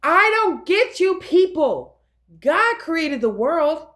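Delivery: high, loud exasperation; exasperated preaching. Real, not acted. Berating people for creating the end with their tongue, exasperated. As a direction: burst out in frustrated, emphatic preaching.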